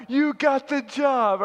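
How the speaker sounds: loud, high, drawn-out call; mock shout. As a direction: holler it jokingly from the back of the room. Boss calls out from the back.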